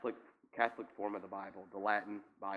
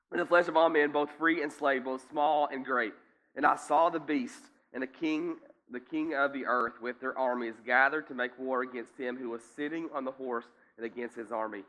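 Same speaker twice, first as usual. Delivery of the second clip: loud, raised scripture reading; preaching at full force; 3 semitones higher, 7 dB louder than usual. Acted. Pastor proclaims the great supper of God, loud and raised. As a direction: thunder the verses out to the church.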